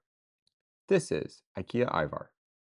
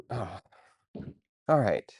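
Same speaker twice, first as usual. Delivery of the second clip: usual speaking voice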